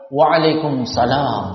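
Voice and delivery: "Waalaikumsalam" pelan, lebih rendah, tanpa "as" pada salam. Shown as a demonstration. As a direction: jawab salam kearab-araban tapi keliru. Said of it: "tapi salah juga". (gaya ngetren yang salah)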